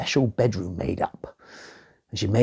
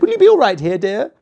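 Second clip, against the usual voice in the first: high, loud sweet-talking queen; 9 semitones higher, 7 dB louder, faster than usual. Acted. Queen asking the princess about her bed, high and coaxing. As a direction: coax her like a scheming queen.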